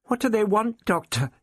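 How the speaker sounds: put-on frightened girl calling to the Doctor; nervously. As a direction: call out anxiously. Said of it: "called nervously".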